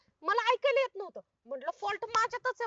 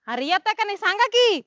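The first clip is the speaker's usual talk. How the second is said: shouted demand for an answer; shouting to be heard over the silence; loud frustrated shout; 11 dB louder than usual. Acted. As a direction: yell it out, losing patience.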